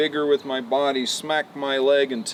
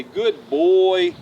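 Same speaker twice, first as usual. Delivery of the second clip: put-on a louder, punchy good boy; hearty praise; 4 semitones higher, 7 dB louder than usual. Shown as a demonstration. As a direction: reward him out loud. Praising the horse.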